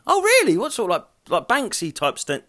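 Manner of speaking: high, mock-surprised quizzing; mock surprise. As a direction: squeal the question in disbelief. Imitated chat with the stall man.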